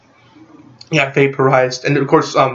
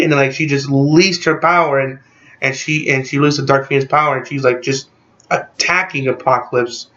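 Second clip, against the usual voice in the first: normal talking voice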